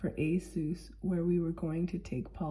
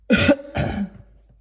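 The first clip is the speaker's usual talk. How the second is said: throat clear; 3 semitones higher, 13 dB louder than usual. Real, not acted. Throat clearing mid-sermon.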